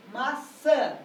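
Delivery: "maçã" slowed, accent on çã; stress on last syllable. Shown as a demonstration. Teacher pointing out the tonic syllable, "maçã" stressed on çã. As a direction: land firmly on the final çã of "maçã". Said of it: "a sílaba tônica aqui".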